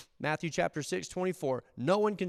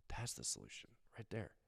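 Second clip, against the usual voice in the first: hushed awe; 6 semitones lower, 15 dB quieter than usual. Retold or imitated. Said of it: "he goes".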